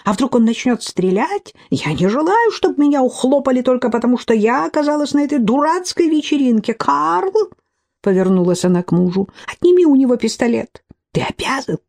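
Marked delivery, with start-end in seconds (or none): 0.0-7.5 high, frantic voice of a frightened lady; panicky society lady. A narrator's reading. Frightened lady to her husband: high, panicky pleas. 9.5-11.8 high, frantic voice of a frightened lady; panicky society lady. A narrator's reading. Frightened lady to her husband: high, panicky pleas. Mrs Blakeley orders husband.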